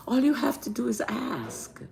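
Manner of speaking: hushed, intimate aside; whispered reassurance. Real, not acted. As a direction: drop to a soft whisper. Reassuring viewers about asking angels.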